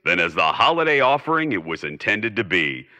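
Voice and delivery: put-on old-time broadcaster's closing address; formal radio sign-off. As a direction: assure the listeners like a broadcaster. Closing announcer speech.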